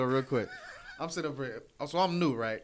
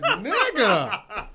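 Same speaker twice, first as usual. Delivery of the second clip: loud incredulous laugh on the word; laughing disbelief; 9 semitones higher, 15 dB louder than usual. Retold or imitated. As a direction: crack up in disbelief. Disbelief at bully waiting.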